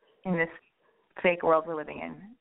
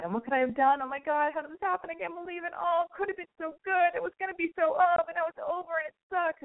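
mock despair; 9 semitones higher than usual. Mock heartbroken self-pity.

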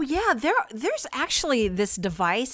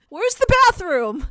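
tourist question; 11 semitones higher, 7 dB louder than usual. Pretend. Tourist asking device.

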